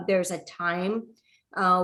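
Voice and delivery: normal talking voice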